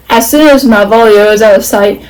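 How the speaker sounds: ordinary delivery, nothing marked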